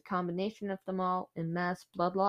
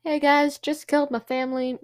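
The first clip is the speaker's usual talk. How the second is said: lightly acted, higher and louder than usual; mock casual announcement; 6 semitones higher, 7 dB louder than usual. Killer telling friends about the family murder, mock casual. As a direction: toss it out like news to friends.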